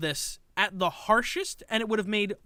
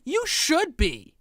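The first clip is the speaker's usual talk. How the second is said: mock smug retort; 6 semitones higher, much slower than usual. Mocking the streamer's reply.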